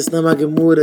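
ordinary delivery

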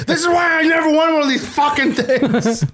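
hammed-up yelling old grump; mock rant. Ribbing friend over cracked phone, mock shouting. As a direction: holler it like a cranky grandpa.

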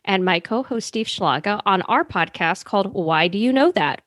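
ordinary delivery

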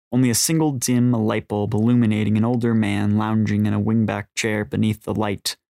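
usual speaking voice

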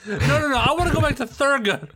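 high, loud excited outburst; excited shout. Real, not acted. Jumps in to return to Thurgood.

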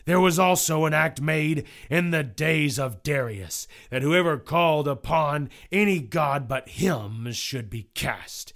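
loud, emphatic courtroom oratory; stern condemning judge. Acted. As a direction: pronounce the case against the accused with force. Judge addresses jury in narrated trial.

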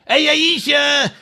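high, drawn-out mock call. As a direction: call her name high and long, as Pete. Acting Pete calling Aisha over.

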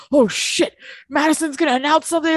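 high, fast mock panic; imitating alarm. Host mimics Craig at the barbecue, fast high mock panic. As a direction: squeak it out like sudden alarm.

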